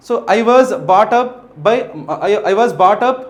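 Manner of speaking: slow, word-by-word English reading. Shown as a demonstration. Teacher reads the jumbled sentence slowly to students.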